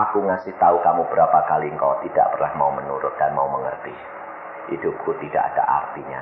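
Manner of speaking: low, subdued, resigned reply; istri putus asa. Retold or imitated. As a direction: answer him quietly and low, without hope. Wife's bitter, hopeless reply to husband. (jawaban lirih istri yang pasrah)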